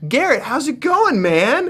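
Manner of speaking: high-pitched, stretched-out friendly greeting; imitating Paul. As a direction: put on a castmate's hearty hello. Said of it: "And he's like".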